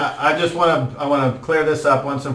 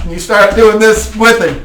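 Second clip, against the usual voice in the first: normal talking voice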